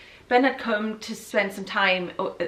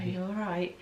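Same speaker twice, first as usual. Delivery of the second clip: much softer, murmured check-in; hushed concern; 4 semitones lower, 11 dB quieter than usual. Retold or imitated. Girlfriend imitating his quiet question, hushed. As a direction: ask it low. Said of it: "He was like".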